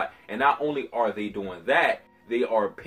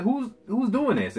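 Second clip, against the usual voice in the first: mock-confused, much higher voice; 12 semitones higher than usual. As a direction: pitch it way up, puzzled. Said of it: "He's like".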